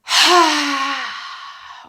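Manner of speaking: long, loud open-mouthed sigh; big sigh. Shown as a demonstration. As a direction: breathe out in a big audible sigh. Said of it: "a lovely big sigh with your mouth open".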